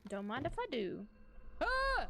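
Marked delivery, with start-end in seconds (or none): 1.6-2.1 short high scream; startled scream. Real, not acted. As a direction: yelp at a jump scare. Jump scare in horror game.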